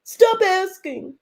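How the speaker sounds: hammed-up whimpering, as if turning on the waterworks; mock tearful. Tisha pleading about questions on her marriage, in exaggerated tearful whine. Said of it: "she put the tears on".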